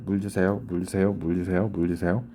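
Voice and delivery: quick-fire repeats of a customer's request; rapid repeated asking. Acted. Customer asking the waiter fifty times for water, rapid repetition. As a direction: run the request together over and over, very fast. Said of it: "you know, fifty times".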